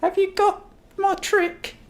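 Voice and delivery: put-on very high, slow child's voice; little girl voice. Lily asks Uncle Ron for his trick in a high child voice. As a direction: ask it like an eager small girl.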